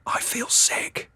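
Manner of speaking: slow queasy whisper. Acted. Husband whispering to wife about the stench, queasy whisper. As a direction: whisper it slowly, feeling ill. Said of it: "you whisper to your wife".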